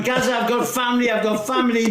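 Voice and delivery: high imitated yelling, clinging on; mock panicked shout. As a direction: cry out like a man hanging off a ladder. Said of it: "He's shouting".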